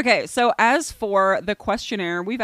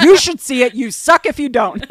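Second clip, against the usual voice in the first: mock bossy yell; 6 semitones higher, 12 dB louder than usual. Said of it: "You're like".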